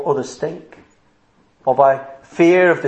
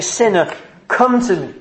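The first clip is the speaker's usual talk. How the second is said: raised, higher-pitched preaching appeal; crying out; 5 semitones higher than usual. Acted. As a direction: call sinners in with urgency, pitch held high. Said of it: "Do you hear him crying out saying".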